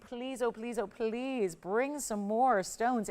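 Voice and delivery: slow, drawn-out mock plea; imitated begging. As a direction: draw out each please like a pleading customer. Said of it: "begging us for, saying".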